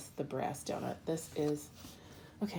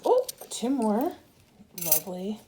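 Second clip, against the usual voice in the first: ordinary talk, nothing marked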